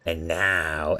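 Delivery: lightly voiced goblin guard taunting; a hint of a sneering jailer. Goblin guard mildly taunts the prisoner through the bars. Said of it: "As he comes down and says".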